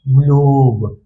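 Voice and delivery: ग्लोब stretched on a long 'oh'; true long O. Shown as a demonstration. Teacher repeating the answer for pupils, ग्लोब with drawn-out 'oh'. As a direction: hold the 'oh' in ग्लोब, then close on B. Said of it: "ओ को तो ओ ही बोलेंगे".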